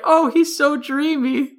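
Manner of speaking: high, slow swooning sigh; swooning interpreter. Pretend. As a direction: swoon like a smitten fan. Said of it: "the interpreter's like".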